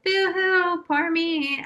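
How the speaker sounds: high, whiny mock sob of poor me; mock her own drunken self-pity in a sing-song whine. Mocking her own drunken phone calls in a high whine. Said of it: "being like".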